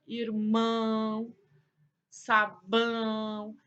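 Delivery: "irmão, sabão" with ão stretched out through mouth and nose; nasal ão demonstration. Shown as a demonstration. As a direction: hold the nasal ending of "irmão, sabão" very long. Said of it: "o sonzinho vai sair não só pela boca, mas também pelo nariz".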